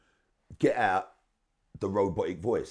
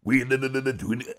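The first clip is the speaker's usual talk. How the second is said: louder droning robot chant; robotic voice; 3 semitones higher, 4 dB louder than usual. Retold or imitated. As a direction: call the hook like a robot on the mic. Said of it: "go on the mic and go".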